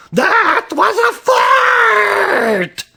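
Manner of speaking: very high, drawn-out group holler; full-blast comic accusation; yelling. A narrator's reading. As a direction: yell it slowly, stretching each word. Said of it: "looked at Carmen and yelled".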